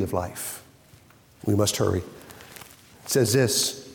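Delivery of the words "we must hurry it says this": normal talking voice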